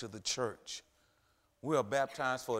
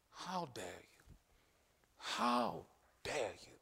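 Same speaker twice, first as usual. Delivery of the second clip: slow, much softer taunt fading into a whisper; devil's taunt; 4 dB quieter, slower than usual. Acted. Preacher voicing the old devil accusing people, slow and soft. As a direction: let the devil drag it out quietly. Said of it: "old devil is saying".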